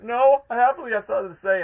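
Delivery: high and loud, hammed up; mock dramatic protest. Fan replaying Ivo's last lines, mock dramatic protest. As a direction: protest with theatrical flair. Acting out Ivo again.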